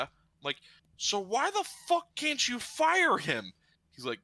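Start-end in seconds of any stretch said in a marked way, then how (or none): 1.0-3.5 high, slow incredulous demand; exasperated disbelief. Retold or imitated. Applicant demands why the manager can't fire him, exasperated. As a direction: stretch out the baffled question, high-pitched.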